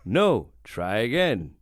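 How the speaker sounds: low, slow electronic toy voice; talking spelling toy. Retold or imitated. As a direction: drag it out like a learning computer. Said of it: "he has a speak and spell".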